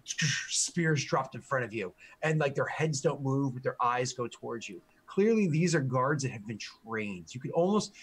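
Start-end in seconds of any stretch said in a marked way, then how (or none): none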